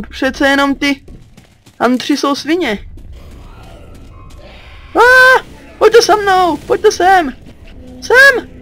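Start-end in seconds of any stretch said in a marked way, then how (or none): 5.0-8.5 calling out. Real, not acted. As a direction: summon your squad across the map. Calling teammates to follow.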